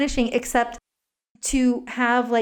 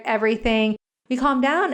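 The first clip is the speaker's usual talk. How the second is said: ordinary talk, nothing marked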